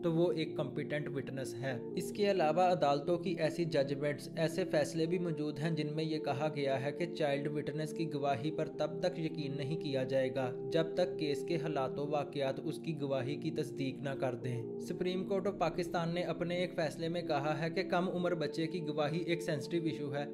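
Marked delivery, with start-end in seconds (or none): none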